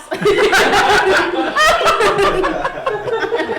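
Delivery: big loud laugh, head back. Real, not acted. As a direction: burst out laughing. Laughing at a joke.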